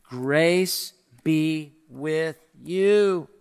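"Grace be with you" said very slowly, each word weighed; powerful, weighty. Shown as a demonstration. Preacher showing the congregation the weight of five words, slow. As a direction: let every word land, slow and heavy. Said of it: "It's a powerful five words".

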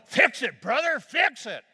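loud, high insistent yell. Retold or imitated. Man waving his arms to get the preacher's attention, loud yell. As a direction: wave for attention and shout it. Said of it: "waving his arms at me".

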